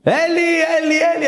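high call across the house to her husband; wife calling Eli, high-pitched. Retold or imitated. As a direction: call his name high, as if from another room.

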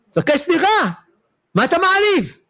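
an indignant rabbi telling him off; retold scolding shout. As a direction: demand the apology sharply.

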